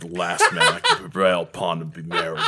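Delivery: fast, laughing put-on British reading; mock British Austen line. Host quotes Pride and Prejudice in a mock British accent. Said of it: "fake British accent".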